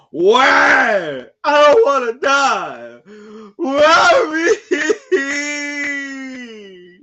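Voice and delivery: hammed-up wail, high and loud, begging not to die; mock wailing cry. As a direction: wail it like a terrified child pleading. Reading a doomed kid's cries.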